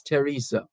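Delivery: "Teresa" begun with a plain T sound; "Teresa" with the H silent. Shown as a demonstration. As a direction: say "Teresa" as teh-REE-sa, no th. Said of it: "the H is silent".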